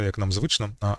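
normal talking voice

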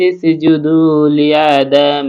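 high, slow chanted tilawat; Quran recitation. Acted. Teacher recites the verse in melodic tilawat, drawn out. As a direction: sing the verse out, long held vowels.

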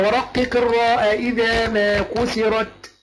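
slow chanted line of the poem; measured recitation; verse recitation. Shown as a demonstration. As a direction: draw the verse out word by word. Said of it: "يبقى".

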